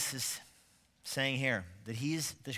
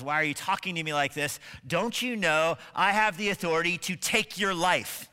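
raised, louder challenge, building in force; a ruler's affronted demand, pitched up; indignant Pilate; 5 semitones higher, 6 dB louder than usual. Acted. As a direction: pull rank on a prisoner, voice climbing. Pilate challenging Jesus during interrogation.

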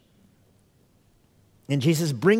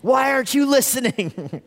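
high-pitched exasperated parent; hammed-up scolding, pitched high; mock parental yell; 10 semitones higher than usual. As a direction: play a parent yelling at kids who ignore them. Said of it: "It's like yelling at your kids".

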